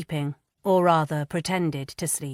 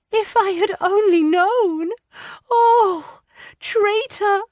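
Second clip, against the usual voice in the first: high, loud and breaking with grief; anguished cry; 6 dB louder, slower than usual. A narrator's reading. Lydia crying out about betrayal before breaking into tears. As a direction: wail it out, betrayed. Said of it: "she cried".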